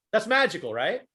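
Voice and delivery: ordinary delivery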